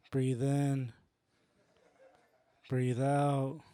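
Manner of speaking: low, sedated mock meditation guide; meditation audiobook. Comic imagining himself reading meditation audiobooks, low mock-soothing. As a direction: lull them with spaced-out, low instructions. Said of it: "imagine if I did meditation audiobooks".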